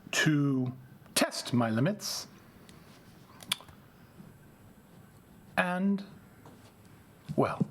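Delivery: a hint of a cold, slow condescending prince; menacing vampire prince. Vampire Prince Antonio addressing the heckling Brujah, slow cold condescension. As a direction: talk down to the upstart, unhurried.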